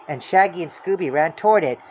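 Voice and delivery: ordinary delivery, nothing marked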